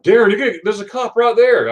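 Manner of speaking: imitated freak-out. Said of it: "he was freaking out".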